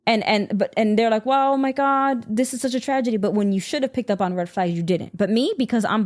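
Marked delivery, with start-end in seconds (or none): 1.3-3.2 mock dismay. Speaker imitating people reacting to a tragedy, mock dismay. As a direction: mimic the onlookers' hollow dismay.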